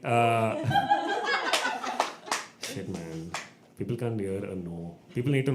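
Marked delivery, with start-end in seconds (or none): 0.6-2.6 long high-pitched laughing fit. Real, not acted. Cracks up mid-story.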